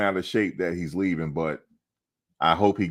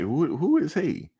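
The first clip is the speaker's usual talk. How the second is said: high, slow puzzled voice; mock confused voice; 12 semitones higher than usual. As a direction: play the baffled stranger asking who he is. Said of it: "They gonna be like".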